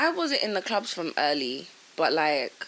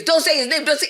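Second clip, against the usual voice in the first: raised, louder imitated protest; mock urgent warning; 7 semitones higher, 5 dB louder, faster than usual. Acts out friend's anticipated warning.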